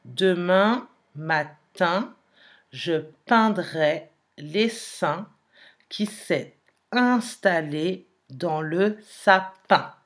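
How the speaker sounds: very slow, syllable by syllable; slow dictation. Shown as a demonstration. Teacher repeating the sentence so pupils count sounds, very slow. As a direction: stretch every word so each sound can be counted. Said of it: "Je répète la phrase".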